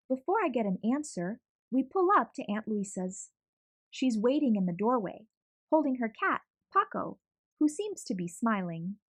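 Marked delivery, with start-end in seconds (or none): none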